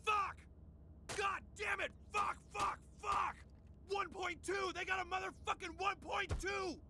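high, shouted cursing tirade; raging sore-loser rant; furious swearing outburst. Acted. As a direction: explode with each curse punched out. Furious outburst after losing to rival.

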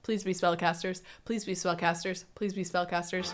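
soft, low repeated wish; hushed pleading. Real, not acted. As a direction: chant it under your breath like a wish. Repeated wish before the birth.

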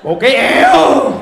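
loud, slow drawn-out whoosh; whoosh exclamation. Retold or imitated. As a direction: stretch it like wind rushing. Describing the speed. (kéo dài như gió thổi)

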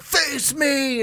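high shouted call; villain challenge. Retold or imitated. Retelling the villain calling out Elba, shouted high. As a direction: yell it as a dare.

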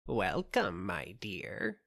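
put-on soft croaky old witch greeting; croaking witch. A narrator's reading. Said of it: "croaked she".

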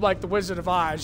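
ordinary delivery

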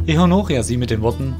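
plain reading voice

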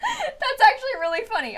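high, squeezed by laughter; amused laughing. Real, not acted. Streamer amused by the villager's timing, laughing. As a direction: say it while still cracking up.

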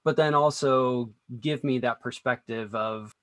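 usual speaking voice

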